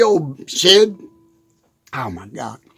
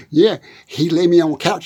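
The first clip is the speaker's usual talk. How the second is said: ordinary talk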